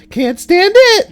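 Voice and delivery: very high, loud wail; high old lady wail. Shown as a demonstration. Retaking the patient's line for Kyle, loud high wail. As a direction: belt it like a fed-up granny. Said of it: "can we get that three more times".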